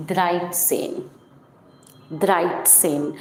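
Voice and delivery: द्राइट सेन with a clear t before the ts; teacher demoing द्राइट सेन, t sound added; German dreizehn. Shown as a demonstration. Teacher to Hindi-speaking students, demoing German thirteen. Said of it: "यहाँ पे आपको एक टी का sound लाना पड़ेगा".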